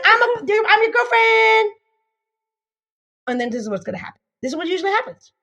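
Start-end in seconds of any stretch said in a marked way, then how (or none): 0.0-1.7 put-on eager girlfriend. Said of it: "Now they're like".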